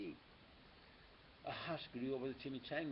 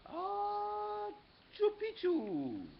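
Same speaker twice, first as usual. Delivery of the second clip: high sneeze-burst into a louder, slow password; big comic sneeze on the word; half sneeze; 8 dB louder, much slower than usual. A narrator's reading. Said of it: "half sneezed, half spoke".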